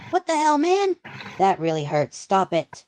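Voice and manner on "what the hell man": pained outcry. Acted. Knocked down, in pain.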